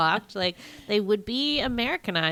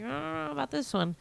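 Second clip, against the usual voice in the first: higher, softer hedging voice; unsure; 8 semitones higher, 5 dB quieter, faster than usual. Retold or imitated. Viewer voicing doubts about the film, unsure. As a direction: voice your doubt in a high, quiet hedge. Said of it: "I'm just not sure about it".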